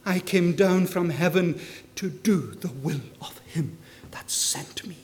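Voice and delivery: whispered, as a private vow; hushed whisper. Acted. Preacher voices Jesus speaking to his father, in a whisper. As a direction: breathe the words barely aloud.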